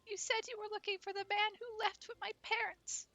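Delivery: put-on little girl voice. Streamer voicing a timid girl looking for her parents. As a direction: squeak it out like a shy small girl.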